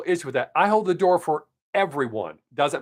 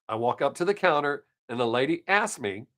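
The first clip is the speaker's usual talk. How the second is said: ordinary talk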